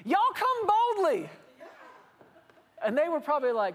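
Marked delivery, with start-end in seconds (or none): 0.1-1.3 high, slow, laughing call; God's invitation, hammed up. God invites the Hebrew people, high and grinning. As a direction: invite them in big and booming. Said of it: "He was telling these people".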